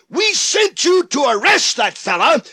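sudden high shouted demand; high shout at the officers; angry demand. Acted. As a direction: bark it like an outraged priest. Preacher voices angry Pharisees.

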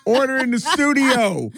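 playful courtroom-style call-out; mock call to order. Real, not acted. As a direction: call the room to order, pitched well up. Calls out over laughter to co-host.

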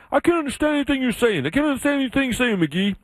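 high-pitched mock complaint; baffled New Englanders. New Englanders complaining to their Southern colleague, high mock exasperation. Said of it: "these New Englanders I work with".

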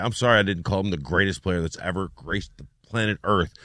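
low, soft deadpan retort; sarcastic apology. Retold or imitated. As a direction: drop down into a dry mock apology. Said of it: "And I was like".

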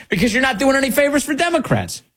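normal talking voice

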